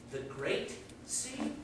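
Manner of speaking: soft, slow questioning echo; hushed disbelief. Acted. Well frog hears of the sea, hushed disbelief. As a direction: repeat it softly and slowly, puzzled.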